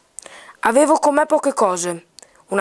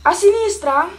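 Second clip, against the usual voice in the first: higher-pitched cried question; boy calling out; 6 semitones higher than usual. Acted. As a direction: call it down from a height, pitched up. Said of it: "gridò il ragazzo".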